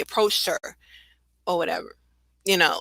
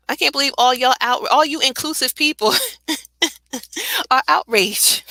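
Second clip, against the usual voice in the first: sarcastic laughing; 6 semitones higher than usual. Real, not acted. Mocking outraged critics.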